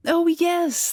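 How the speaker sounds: high-pitched, emphatic agreement; eager courtier. A narrator's reading. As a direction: agree effusively, voice lifted high. Courtier nodding hard in eager agreement.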